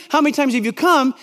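usual speaking voice

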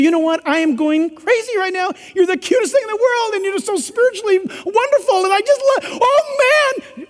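hammed-up infatuated girl; swooning girlfriend. Girlfriend gushing over her boyfriend, high mock swoon. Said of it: "If she says to you".

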